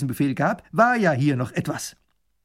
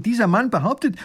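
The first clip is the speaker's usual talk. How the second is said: ordinary delivery, nothing marked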